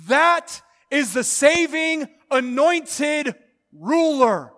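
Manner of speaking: slow, loud, drawn-out proclamation; booming slow declaration; proclaimed confession. Acted. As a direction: declare it like the whole world is bowing. Preacher voicing every tongue confessing.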